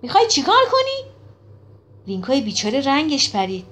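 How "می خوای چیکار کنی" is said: loud, high-pitched menacing demand; stern outraged challenge, voice jumping up; firm, threatening. A narrator's reading. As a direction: burst out with a threatening question. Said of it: "با تهدید به او نگاه کرد و با صدای محکم پرسید".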